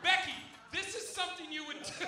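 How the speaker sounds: high-pitched mock accusation; imitated accusing girl. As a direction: pin it on her in a high girl's voice. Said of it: "immediately they're on her".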